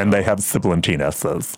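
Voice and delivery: low put-on voice with sibilant S's; deep voice, play up every S; bass baritone with sibilant S's. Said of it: "have to be bass baritones".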